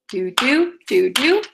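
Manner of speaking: "do, do" in pairs, weak then strong; heartbeat rhythm. Shown as a demonstration. Teacher sounds out the heartbeat, paired "do, do" beats. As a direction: pulse "do, do" like a beating heart. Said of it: "So the heartbeat".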